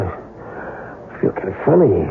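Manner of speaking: slow, drawn-out uneasy words; feeling ill. Acted. Husband starting to feel the poison, slow and uneasy. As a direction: let the sickness creep into the voice.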